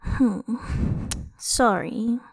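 soft mumbled apology. A narrator's reading. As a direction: mumble it sheepishly. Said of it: "she mumbled".

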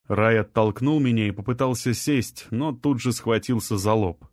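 ordinary delivery, nothing marked

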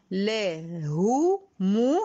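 "له مو" slowly, each u drawn out and rounded; successive damma vowels. Shown as a demonstration. Teacher showing consecutive dammas, "له مو" slow with rounded u. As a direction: separate "له مو" into two long, clear u sounds. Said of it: "أدي تتالي ضم".

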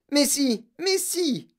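high-pitched, eager insisting; insistent contradiction. Acted. Girl contradicting the candy maker, high and insistent.

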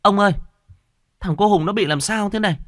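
high, alarmed call to a husband; worried mother calling in high-pitched alarm; anxious urgency. A narrator's reading. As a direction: cry out for help, loud at first. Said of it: "bà ngoại sốt sắng".

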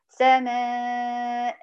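سماء held out very long on a level tone; stop with long alif. Shown as a demonstration. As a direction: stretch the final vowel of سماء as a natural madd. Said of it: "بألف مد طبيعي".

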